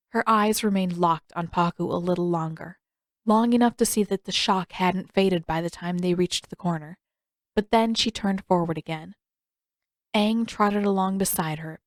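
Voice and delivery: ordinary delivery, nothing marked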